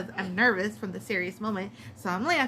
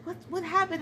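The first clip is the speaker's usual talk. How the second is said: startled awake; 8 semitones higher than usual. Retold or imitated. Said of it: "waking up, like".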